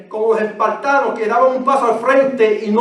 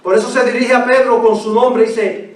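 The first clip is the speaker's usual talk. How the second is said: usual speaking voice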